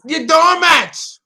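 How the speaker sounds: slow, high, loud jeer; drawn-out mock yell, pitched high; mock scolding shout. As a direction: call them out like a heckler.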